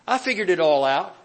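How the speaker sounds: mock arrogant churchgoer; cocky know-it-all. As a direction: puff yourself up like you've got it all. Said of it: "Do we have that attitude sometimes".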